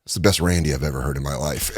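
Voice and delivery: low murmured praise from a veteran crew guy; gruff old cameraman impression, hushed; older camera guy. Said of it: "He goes".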